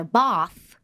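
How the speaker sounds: "bath" said with a broad "ah"; long English A. Shown as a demonstration. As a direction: open the A in "bath" to "bahth". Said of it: "You say them like that".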